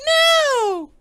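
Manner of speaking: put-on very high, loud kid squeal; mock squealed denial. Playing Drake insisting to his dad, squealed mock denial. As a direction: deny it in a shrieking kid voice. Acting the kid's louder denial.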